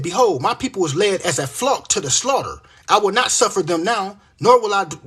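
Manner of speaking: loud, driving scripture reading; scripture preaching. Acted. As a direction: proclaim the prophecy forcefully.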